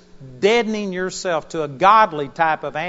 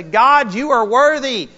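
exultant praise; 7 semitones higher, 7 dB louder than usual. Retold or imitated. Voicing rejoicing saints and angels.

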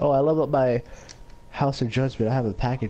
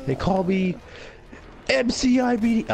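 hype announcer; 10 semitones higher than usual. Pretend. Boastful self-hype in game.

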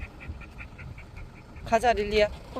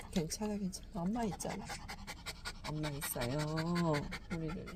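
soft, hushed comfort; calm a frightened little one gently; 10 dB quieter than usual. Real, not acted. Comforting scared dog softly.

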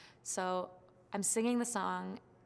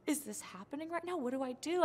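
much higher, pleading bewilderment; flustered panic; 6 semitones higher, 3 dB quieter than usual. Retold or imitated. Contestant asking her family what to do, flustered. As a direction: beg for help, overwhelmed.

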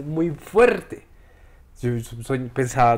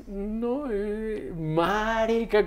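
voice breaking; 7 semitones higher, 4 dB quieter, much slower than usual. Retold or imitated. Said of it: "empezó la voz así como".

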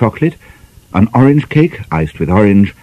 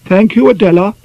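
curt, pointed thanks in a high voice; a sharp edge under politeness; with asperity; 12 semitones higher, 3 dB louder than usual. Acted. Guest answering her hostess with asperity. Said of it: "with some asperity".

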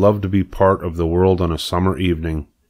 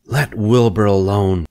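sharp. Acted. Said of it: "she spoke sharply to the lamb".

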